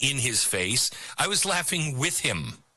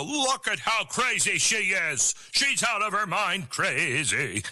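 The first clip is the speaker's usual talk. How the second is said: higher mocking jeer at a crazy girl; mocking scoff; 5 semitones higher than usual. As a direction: sneer like an older critic mocking her. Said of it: "people my age would make fun of her and go".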